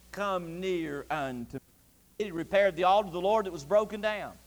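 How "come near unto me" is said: low, very slow, soft invitation; hushed summons. Retold or imitated. Elijah calling the people closer, hushed summons. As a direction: draw the listeners in slowly and quietly.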